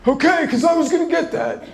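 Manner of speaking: high, put-on dad voice backing off; a hammed-up cheap dad; dad's voice. Dad relieved the kids will pay, in a high mock voice. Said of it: "That's a cue for my sister and I to say".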